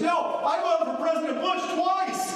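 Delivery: hammed-up booming confession; loud hard-of-hearing talk. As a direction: senator announcing his vote to a room of Democrats. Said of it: "he's talking like this".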